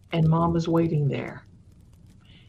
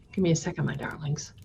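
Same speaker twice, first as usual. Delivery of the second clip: soft whispered aside; 4 semitones lower, 8 dB quieter, faster than usual. Real, not acted. Storyteller pausing for a text, whispering aside. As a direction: murmur it off-mic to the audience.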